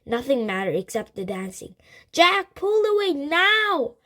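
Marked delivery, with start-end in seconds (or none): 2.1-3.9 high, loud, drawn-out call; urgent shout. A narrator's reading. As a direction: shout it slowly to break through to him. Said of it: "Annie shouted again".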